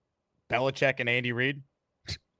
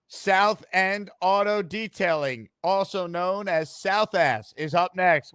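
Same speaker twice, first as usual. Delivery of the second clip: announcer intro; 4 semitones higher, slower than usual. Acted. Host announces caller's name.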